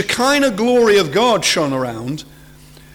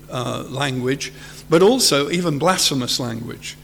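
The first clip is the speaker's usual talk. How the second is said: ordinary delivery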